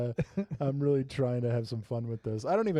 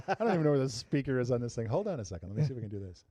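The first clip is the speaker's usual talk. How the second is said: usual speaking voice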